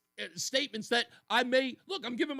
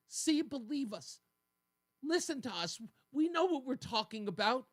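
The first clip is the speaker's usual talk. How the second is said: higher, lightly whiny insistence; mock pleading; 3 semitones higher than usual. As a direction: imitate someone begging to be believed. Voices media begging to be believed.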